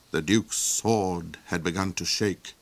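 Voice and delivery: plain reading voice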